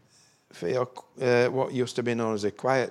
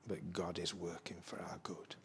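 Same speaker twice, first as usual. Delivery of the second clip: hushed whisper; 6 semitones lower, 15 dB quieter than usual. Acted. Preacher drops to hushed assurance.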